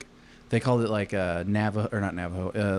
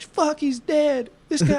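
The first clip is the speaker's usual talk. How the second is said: much higher, slow wailing cry; hammed-up panic; 3 dB louder, slower than usual. Soldiers wailing that the general was dead, much higher and slow. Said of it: "Everybody was like".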